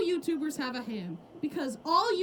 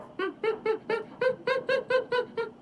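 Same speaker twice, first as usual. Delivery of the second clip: dopey laugh; 8 semitones higher than usual. Acted. Smooth brain laugh.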